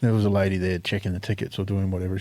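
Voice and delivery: ordinary talk, nothing marked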